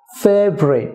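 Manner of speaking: "ফেভারিট" as fev-rit, middle vowel dropped; two-syllable favourite. Shown as a demonstration. Teacher repeats for viewers, "ফেভারিট" as fev-rit. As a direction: say "ফেভারিট" as FAYV-rit with a fall. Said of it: "two syllable হবে".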